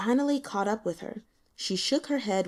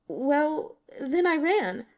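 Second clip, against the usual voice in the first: put-on higher, halting girl's voice; hesitant young woman; 6 semitones higher, slower than usual. A narrator's reading. Li Qian evading the investigator, faltering. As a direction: stumble over the admission.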